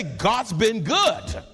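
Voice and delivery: slow each word down, grateful and sure; slow grateful declaration. Shown as a demonstration. Pastor shows the church how to answer, slow and grateful. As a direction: let God's been good land slowly. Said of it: "No, just say".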